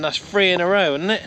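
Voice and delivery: normal talking voice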